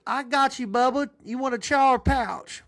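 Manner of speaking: put-on high, loud good-ol-boy brother; big brother. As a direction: brother offering his chew, high and loud.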